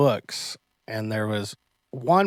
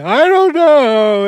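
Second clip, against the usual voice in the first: very high, slow, loud mock wail; imitated crying; 11 semitones higher, 9 dB louder, much slower than usual. Crying Yuvi answers why he's scared, mock wail. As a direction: drag it out like a bawling child. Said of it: "he's just crying and crying and crying".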